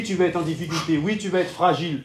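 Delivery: usual speaking voice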